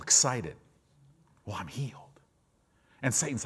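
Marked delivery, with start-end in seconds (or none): 1.4-2.2 whispering it, low and soft; a quiet whispered sigh of relief. Retold or imitated. Voicing a relieved believer.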